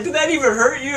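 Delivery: high, surprised question; stranger. Retold or imitated. Punched stranger asking Ryan, high and baffled.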